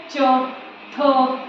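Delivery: च and थ said as bare single sounds; isolated phoneme demo. Shown as a demonstration. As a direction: say च, pause, then थ, each on its own. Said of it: "हम बोल देते हैं च".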